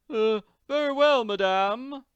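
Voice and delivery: very high, loud servant voice; second obedient servant. Acted. Second servant accepting Missus Warbucks's orders, high and deferential. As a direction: squeak out a dutiful reply.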